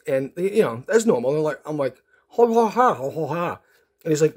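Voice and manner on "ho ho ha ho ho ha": open-mouthed, wordless muffled syllables; mouth-guard babble. Retold or imitated. Patient answering the dentist, mouth-guard babble. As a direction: imitate talking with a stuffed mouth. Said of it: "put that big mouth guard in".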